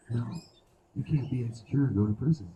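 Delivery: ordinary delivery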